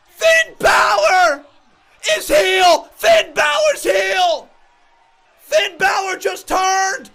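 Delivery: loud, slow, stretched-out shouting; drawn-out yell. Real, not acted. Fan yelling the heel turn news to viewers, slow and loud. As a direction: bellow each word out slowly.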